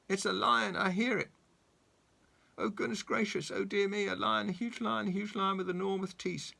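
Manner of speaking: high panicky babble; fretful rising panic. Acted. Mister Jelly panics about lion.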